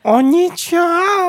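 high, slow, drawn-out amazed cry; mock amazement. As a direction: stretch it out in huge mock surprise. (протяжное наигранное изумление)

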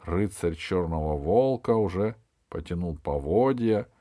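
ordinary delivery

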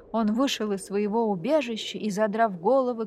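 ordinary delivery

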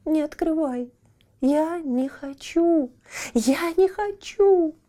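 высоко и медленно, умоляя; испуганная мольба; отчаянно. A narrator's reading. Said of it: "отчаянно заплакала Метель". (Метель испуганно упрашивает не открывать, высоко)

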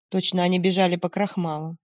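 ordinary delivery, nothing marked

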